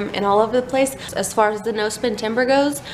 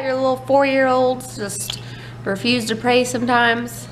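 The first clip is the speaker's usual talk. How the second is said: ordinary talk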